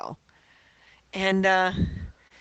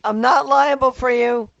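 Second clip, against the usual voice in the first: loud; 5 semitones higher, 10 dB louder than usual. Acted. Joking refusal, loud laughing.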